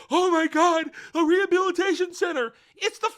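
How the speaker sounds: high, loud, shrieking mock meltdown; hysterical mock freakout. Sentenced prisoner mocking her rehab sentence, hysterical high shriek. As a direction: squeal it like a panicked prisoner being sentenced. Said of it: "which she goes".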